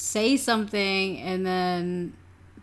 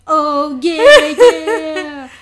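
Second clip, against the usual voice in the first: loud, higher cheer through laughter; mock gloating; 5 semitones higher, 12 dB louder, much slower than usual. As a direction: imitate oblivious gloating, cracking up. Mimicking her carrying on through silence.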